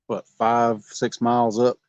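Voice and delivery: usual speaking voice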